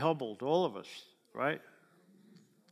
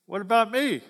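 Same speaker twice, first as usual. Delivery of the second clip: sulky brother; 4 semitones higher, 5 dB louder than usual. Retold or imitated. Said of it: "He said, uh".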